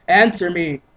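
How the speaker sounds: loud sharp command. Acted. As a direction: bark the order.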